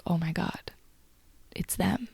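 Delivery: very soft, near-whispered realization; softly stunned, almost voiceless; hushed, chills. Real, not acted. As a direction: say it under your breath in awe. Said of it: "chills all over saying that".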